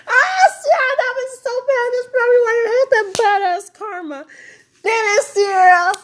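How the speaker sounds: loud, high, anguished outburst; upset self-scolding. Real, not acted. Woman berating herself over bad karma, upset shouting. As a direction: yell at yourself in real distress.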